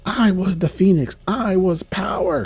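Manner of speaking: lightly hammed-up grandeur; grand villain. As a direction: boast of lost power. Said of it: "She's like".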